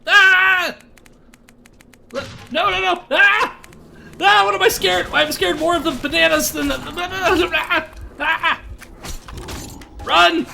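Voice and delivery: high frantic yelps; panicked yelling. Real, not acted. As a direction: yell in a real panic. Monsters attacking, screaming.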